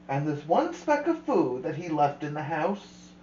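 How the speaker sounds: usual speaking voice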